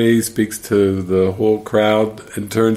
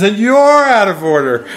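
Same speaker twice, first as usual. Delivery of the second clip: hammed-up movie shout, much higher and louder; acted courtroom outburst; 11 semitones higher, 6 dB louder than usual. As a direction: hurl it at the judge in mock outrage.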